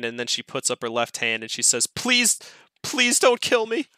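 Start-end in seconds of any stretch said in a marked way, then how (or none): 1.9-3.9 high-pitched begging for life; pained pleading. Acted. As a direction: plead as a wounded victim, much higher. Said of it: "she screams out in pain".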